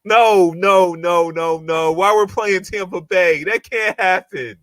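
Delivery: high, slow cry of disbelief, no no no; real dismay at having to play Tampa Bay; shocked protest. Real, not acted. Schedule shock at Tampa game.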